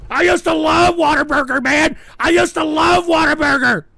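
high, loud lament; anguished yelling. Real, not acted. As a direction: wail it out, aggrieved at what was lost. Host laments ruined burger chain.

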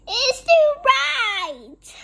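high, loud, drawn-out yell; storybook yell, stretched long; yelled complaint. A narrator's reading. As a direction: wail in protest at the glare. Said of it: "Louis yelled".

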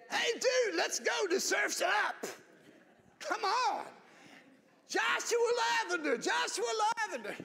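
loud, high, hammed-up surfer dude call; embarrassing surfer dad. Preacher imitating a surfer dad picking up his son, loud and goofy. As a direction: yell it across the school lot like a goofy dad.

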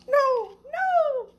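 very high, loud squealed nos; a critter squeals no no no; squeaky animal. Acted. Critter in the box squeals no three times, high and loud.

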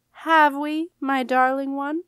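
pressing demand. A narrator's reading. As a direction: force the answer out of her. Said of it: "eyes bored into Jenna".

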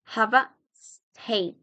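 slow "Have a" as "hava", then "steak"; slow linked demonstration of "Have a"; joined "Have a". Shown as a demonstration. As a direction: link "Have a", drawing out "steak".

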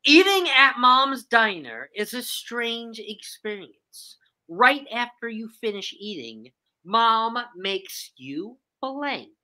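slow question read, word by word; slow, deliberate reading. Shown as a demonstration. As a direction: read the clue slowly and clearly. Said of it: "say the question again".

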